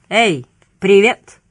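slow, loud, teasing hello; taunting singsong hail; mocking greeting. A narrator's reading. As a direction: draw out the greeting with a jeer. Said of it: "глумливо заговорил".

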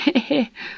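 put-on awkward, sheepish old laugh; embarrassed laugh. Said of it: "сконфуженно рассмеялся". (сконфуженный смех)

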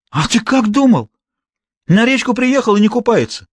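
high-pitched exasperated rebuke; indignant. A narrator's reading. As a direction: scold as if it were obvious. Boss snaps at reluctant henchman. (возмущённо, на повышенных тонах)

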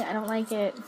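normal talking voice

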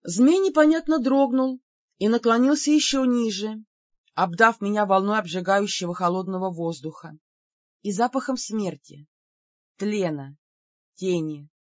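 ordinary delivery

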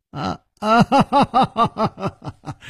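high, staccato acted laughter; hearty laugh. A narrator's reading. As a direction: burst out in a big ha-ha laugh. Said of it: "南宫灵也大笑". (放声大笑)

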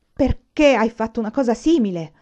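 loud, angry demand; sudden yell. A narrator's reading. Father suddenly yelling at his thieving son, loud angry demand. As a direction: bellow the question. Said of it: "urlò improvvisamente suo padre".

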